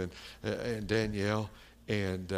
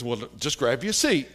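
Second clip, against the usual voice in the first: louder, curt imitated old woman; short, standoffish; 3 semitones higher, 7 dB louder than usual. Old woman seating her visitors, curt mock order. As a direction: order a guest to sit, standoffishly. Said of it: "like that".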